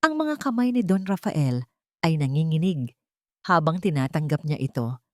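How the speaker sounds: usual speaking voice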